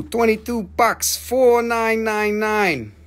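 live sale host spelling out the price; slow price call. Acted. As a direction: call the price slowly like an auctioneer. Announcing price and item number.

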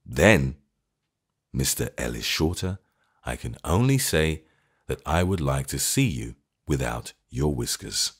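clear, measured words; deliberate. A narrator's reading. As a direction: say each word clearly and deliberately. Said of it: "with great clearness and deliberation".

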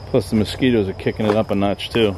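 normal talking voice

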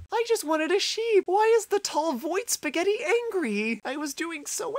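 slow, high wail of complaint; high-pitched whine. Real, not acted. Player killed by a monster laments, high-pitched whine. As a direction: whine it slowly, feeling wronged.

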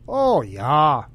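hammed-up Minnesota accent. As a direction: parody an unattractive Minnesota accent on a drawn-out phrase. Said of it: "Minnesota accents are not attractive".

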